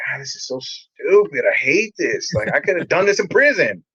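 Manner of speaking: whiny, swooping mock complaint; exasperated griping. New resident griping about eight hours at church, exasperated. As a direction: gripe with pitch climbing at the end.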